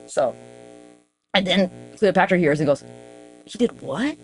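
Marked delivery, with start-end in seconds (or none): 3.5-4.2 acted disbelief climbing to a high pitch; mock-stunned lover's high-pitched questioning. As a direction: start hushed, then rise into astonishment. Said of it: "seems actually stunned by that".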